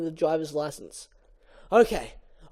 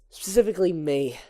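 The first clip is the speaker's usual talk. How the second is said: usual speaking voice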